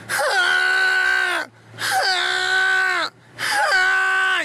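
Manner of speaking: loud, high, drawn-out gym grunt-scream; hammed-up workout yells, one after another; mock straining yell. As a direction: imitate a show-off straining at tiny weights. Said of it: "that guy at the gym".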